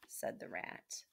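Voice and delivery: ordinary delivery, nothing marked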